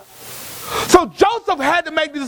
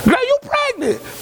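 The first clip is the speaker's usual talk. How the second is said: knowing tease; 10 semitones higher than usual. Retold or imitated. Voicing onlookers teasing a pregnant woman.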